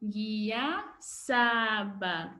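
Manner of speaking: "Ngiyasaba" very slow and syllabled; slow drill word. Shown as a demonstration. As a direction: lead the class through "Ngiyasaba" slowly. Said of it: "Say with me".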